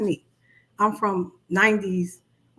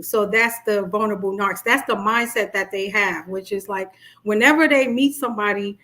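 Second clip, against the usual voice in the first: ordinary talk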